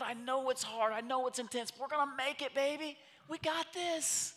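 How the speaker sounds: put-on high, sing-song comforting voice to a child; soothing mother. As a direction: coo reassurance at a whiny kid. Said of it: "She goes".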